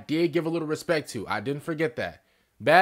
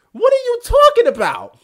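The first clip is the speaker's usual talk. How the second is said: much higher and louder, shouting in disbelief; incredulous yell; 11 dB louder than usual. Real, not acted. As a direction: yell it at the screen in real shock. Baffled reaction to orc speech.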